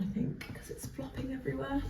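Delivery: whispered under the breath; quick whispered aside to herself. Real, not acted. Aside while letting hair down.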